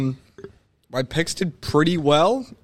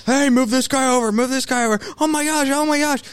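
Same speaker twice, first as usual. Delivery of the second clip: mock screaming coach; 12 semitones higher than usual. Imitating Sarkisian screaming at his quarterback, high and frantic. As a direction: yell like a panicking coach. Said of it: "He's screaming at the quarterback".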